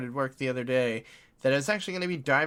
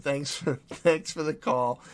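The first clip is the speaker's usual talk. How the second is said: laughing thanks. Real, not acted.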